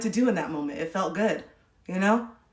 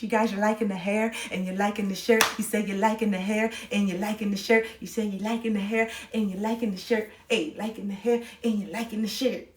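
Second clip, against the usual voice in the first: softer silly sing-song voice, playing around; goofy put-on voice; 5 dB quieter than usual. To live chat about her hair and shirt, goofy put-on voice. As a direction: hum it out in a goofy character voice. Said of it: "I'm changing my voice like this out of nowhere".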